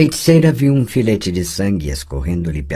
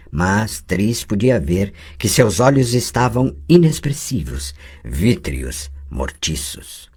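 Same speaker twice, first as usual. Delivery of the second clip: ordinary talk, nothing marked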